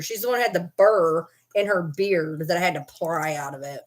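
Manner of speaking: ordinary talk, nothing marked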